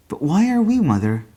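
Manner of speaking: put-on high, insistent boyish question; boy pressing. A narrator's reading. Paul pressing his mother why they are poor, high and boyish. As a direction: push the question like a puzzled child.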